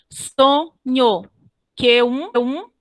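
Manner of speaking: "Sonho" split in two beats, "Q um" run together as one; teacher's demo of poetic syllable division; syllable-by-syllable scansion. Shown as a demonstration. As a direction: scan it beat by beat, pausing between syllables. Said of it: "Olha como foi feita a metrificação".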